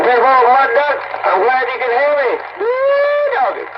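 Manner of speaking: high and loud, hollering happily; calling out happily to Mud Duck over the radio; excited radio call. Real, not acted.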